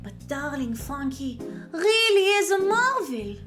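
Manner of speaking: put-on gushing, theatrical French-accented lady; grand French-accented swoops with big emphasis; effusive French warden. Voices a game character.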